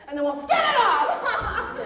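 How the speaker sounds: loud, slow, laughing rally shout; gleeful rallying cry. Acted. Speaker hypes the audience, laughing loud rally cry. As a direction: whip the crowd up as you stretch it out.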